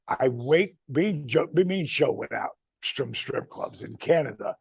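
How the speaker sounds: ordinary delivery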